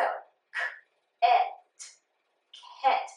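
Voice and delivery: k, et, ket said sound by sound. Shown as a demonstration. Teacher sounding out ket for the class. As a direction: blend k and et into ket slowly. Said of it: "that vowel is saying its short sound".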